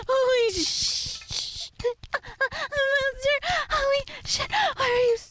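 high, straining moans and gasps; acted pleasure cries. Waitress crying out while her master takes her. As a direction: cry out with rising intensity.

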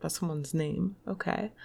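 ordinary delivery

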